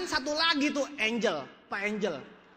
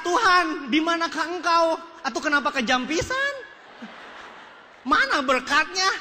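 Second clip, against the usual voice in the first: loud, high mock plea to heaven; protesting to God; 5 semitones higher, 4 dB louder than usual. Disappointed speaker looks up and questions God, loud mock protest. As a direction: cry out a hammed-up complaint to God. Retold complaint shouted up to God.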